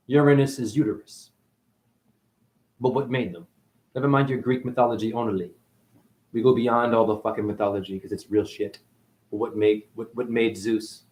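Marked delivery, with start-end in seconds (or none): none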